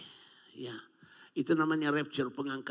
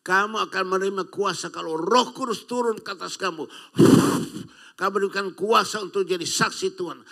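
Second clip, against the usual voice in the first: higher and louder, rising fervour; full-force preaching; 5 semitones higher, 6 dB louder than usual. Real, not acted. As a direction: proclaim it to the whole congregation. (khotbah berapi-api dengan suara tinggi dan keras)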